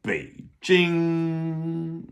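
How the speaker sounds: "Beijing" said with a high, level first tone; first tone demo. Shown as a demonstration. Teacher shows students the first tone in "Beijing". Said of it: "with the first tone".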